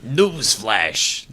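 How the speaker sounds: bad mobster voice; tough-guy gangster announcer. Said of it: "I'm doing horrible with the mobster voice".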